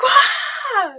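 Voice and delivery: a sudden high, loud cry of thrill; excited shout. Retold or imitated. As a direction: let the wow burst out, high and loud. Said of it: "you're like".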